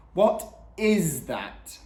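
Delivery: slow, drawn-out cry over the din; appalled cry. A narrator's reading. Dad reacts to deafening metal music, crying out. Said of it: "cried Dad".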